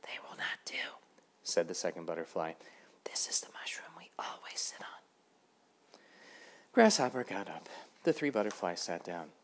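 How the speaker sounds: soft breathy whisper, no voice; three little butterflies whispering their claim. A narrator's reading. Butterflies telling Grasshopper to move, in a soft whisper.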